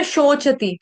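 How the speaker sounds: "शोचति" with a sh start and a distinct short cha; correct sh sound. Shown as a demonstration. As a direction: say "शोचति" as sho-cha-ti, three syllables. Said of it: "इसको सोचती नहीं पढ़ेंगे". ("शोचति" में शरबत वाला श)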